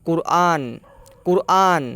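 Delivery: কুরআন sounded out for learners; কুরআন with a stressed first syllable; Malay word Quran. Shown as a demonstration. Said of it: "যেমন".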